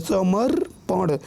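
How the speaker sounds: समर्पण slow, pause between समर and पण; split pronunciation. Shown as a demonstration. Teacher showing प follows the reph, समर्पण slowly split. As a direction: break समर्पण after the र. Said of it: "फिर प हम बोल रहे हैं".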